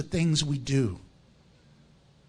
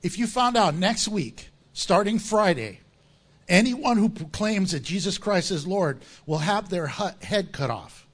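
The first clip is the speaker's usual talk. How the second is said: usual speaking voice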